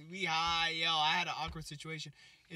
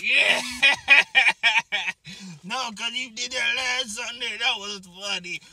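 a lightly voiced cackling old lady, louder; imitating the toothless lady; 5 semitones higher, 12 dB louder than usual. Toothless lady laughing with his friend, lightly voiced. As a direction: laugh loudly as the lady, then chat. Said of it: "She's like".

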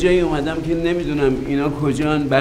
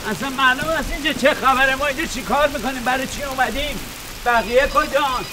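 anxious calling; 10 semitones higher than usual. Acted. Lost, calling for the others.

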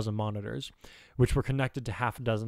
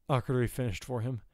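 usual speaking voice

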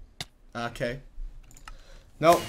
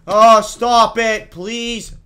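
high, drawn-out plea; exasperated pleading; 7 semitones higher, 13 dB louder, much slower than usual. Real, not acted. Gamer begging the mean map, exasperated pleading. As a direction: beg slowly and loudly, fed up.

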